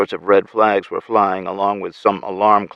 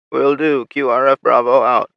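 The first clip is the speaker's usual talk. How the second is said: lightly voiced raspy soldier; crisp military radio report; raspy female soldier; 5 semitones higher, 5 dB louder than usual. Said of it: "her raspy voice".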